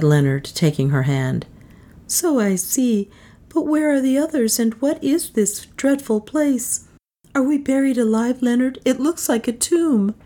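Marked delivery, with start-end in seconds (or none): 2.1-10.1 high, anxious woman's voice; bewildered captive woman, alarmed; frightened young heroine. A narrator's reading. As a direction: ask in rising dread, pitched high.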